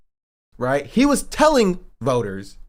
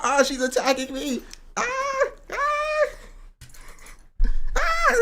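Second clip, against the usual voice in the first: high playful yelps; slower than usual. Real, not acted. Dog attacks, playful cries.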